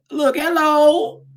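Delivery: a light imitation of a mom's slow, high hello. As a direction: drawl hello like a friend's mother would. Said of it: "say hello like your mom does".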